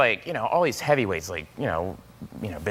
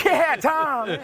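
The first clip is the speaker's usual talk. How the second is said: game-show cheer; 12 semitones higher than usual. Retold or imitated. Cheering Tom Cruise as if he won a game show, high and excited. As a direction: cheer like a contestant just won.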